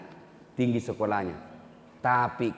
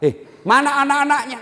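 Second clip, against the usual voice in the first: startled question; 13 semitones higher, 6 dB louder than usual. Retold or imitated. Retelling his startled question to widow.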